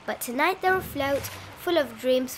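ordinary delivery

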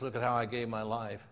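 low, soft, understated reply; lightly voiced Jesus. Jesus answers the proud giver, low and quiet.

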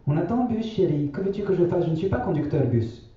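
grand-mère, voix bien plus aiguë et douce. A narrator's reading. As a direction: prendre la voix haute de la vieille grand-mère. (grand-mère qui répète sa maxime aux enfants, voix aiguë)

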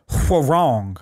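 "Hwoarang" drawn out slowly; slow, careful attempt at "Hwoarang"; labored pronunciation. Real, not acted. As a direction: sound out "Hwoarang" slowly, unsure of the name. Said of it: "never been able to say it".

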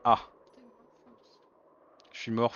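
ordinary delivery

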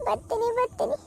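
put-on remorseful old aunt. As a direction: wail out your regret, then curse her. Same old aunt figure. (ಪಶ್ಚಾತ್ತಾಪದ ಅಳುವ ಚಿಕ್ಕಮ್ಮ)

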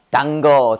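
danger misread as "단거", dan-geo. Retold or imitated. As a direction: read danger letter by letter, Korean-style "단거". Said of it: "뭐라고 읽어?".

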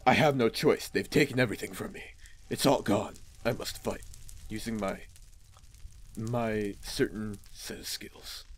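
low, hushed action-hero gravity; grim avenging son. Acted. Son tells father he must fight, low grim voice. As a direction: sink deep and quiet, slowing toward the end.

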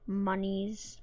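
muttered low aside; deadpan. Acted. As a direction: drop it low and dry. Repeating the joke word.

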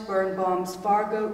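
ordinary talk, nothing marked

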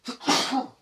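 sudden loud cough; real coughing fit. Real, not acted. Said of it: "Ooh, that's me".

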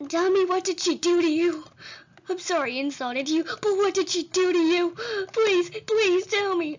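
hammed-up desperate plea; mock pleading. Player begs the dummy for an answer, mock pleading.

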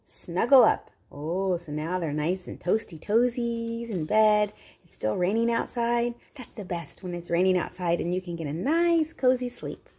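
ordinary delivery

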